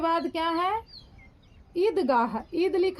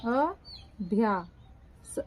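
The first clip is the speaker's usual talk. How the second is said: slow dictation; 5 semitones lower, much slower than usual. Shown as a demonstration. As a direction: stretch अभ्यास out syllable by syllable.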